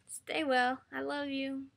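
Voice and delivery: usual speaking voice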